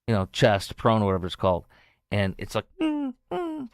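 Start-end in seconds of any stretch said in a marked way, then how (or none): 2.8-3.7 high, quiet whimpering dog noise; imitated dog whine. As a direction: whine softly like an eager dog.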